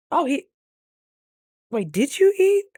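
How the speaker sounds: startled high call to the dog; sudden surprised question. Real, not acted. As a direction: catch yourself and ask the dog. Calling to the dog.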